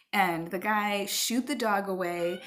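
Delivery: usual speaking voice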